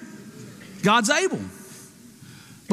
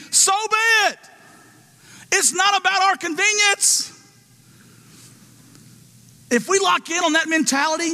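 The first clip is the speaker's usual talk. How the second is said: preaching at full force; 7 semitones higher than usual. Acted. Preaching climax.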